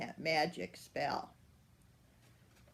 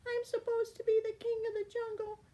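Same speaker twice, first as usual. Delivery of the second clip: much higher, fast crying cat wail; whining cat; much faster than usual. Acted. The lion-turned-cat whimpers as he runs off, high and fast. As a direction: wail like a small sulking kitten. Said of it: "he meowed".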